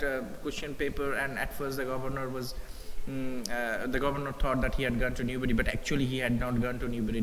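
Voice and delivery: ordinary talk, nothing marked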